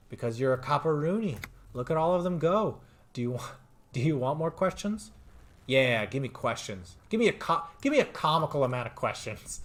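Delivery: a gleeful tie egging its owner on; cheeky talking necktie. Acted.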